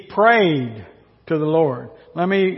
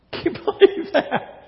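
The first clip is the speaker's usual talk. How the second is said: laughing; 10 semitones higher, 4 dB quieter than usual. Real, not acted. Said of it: "laughing at Jonah".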